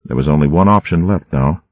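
ordinary delivery

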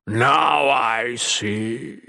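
very slow monstrous rumble; rumbling monster. A narrator's reading. Monster realising who faces him, slow rumble. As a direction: rumble it out, stretching every word. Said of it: "Mickig rumbled".

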